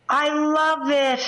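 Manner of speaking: high, slow gushing delight. Real, not acted. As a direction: swoon over the idea, drawn out. Delighted by reindeer idea.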